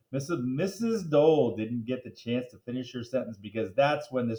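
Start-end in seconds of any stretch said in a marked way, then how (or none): none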